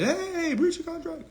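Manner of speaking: very high, sing-song calling out; cartoonish high voice, tossing off claims; mock naive filer. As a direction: blurt the claims like a clueless filer. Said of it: "You just said".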